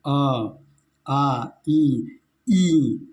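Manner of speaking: अ and ई said short, आ and ई drawn out longer; short versus long vowels. Shown as a demonstration. Teacher shows students short and long vowels, drawing the long ones out. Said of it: "हर्ष ई के उच्चारण में थोड़ा कम समय लेना है और दीर्घ ई के उच्चारण में थोड़ा ज़्यादा समय".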